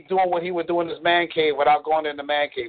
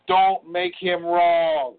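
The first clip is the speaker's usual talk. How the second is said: slow and deliberate; 2 semitones higher, much slower than usual. Acted. States commandment slowly.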